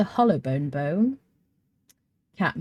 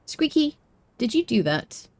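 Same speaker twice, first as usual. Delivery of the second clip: ordinary delivery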